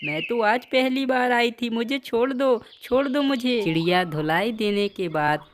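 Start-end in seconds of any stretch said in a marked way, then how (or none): 0.0-3.6 high-pitched begging for mercy; frantic pleading. Acted. Trapped crow thief begs the bird beating her to stop, frantic pleading.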